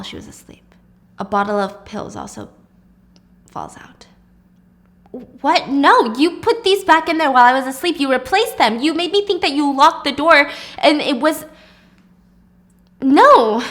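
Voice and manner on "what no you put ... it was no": raised, frantic accusation; hysterical. A narrator's reading. Wife accuses husband before onlookers, hysterical. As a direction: protest frantically, building to a loud cry. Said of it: "she sounded absolutely hysterical".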